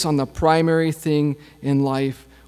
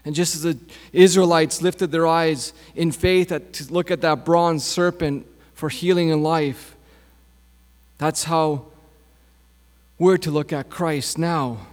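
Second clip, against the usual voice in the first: usual speaking voice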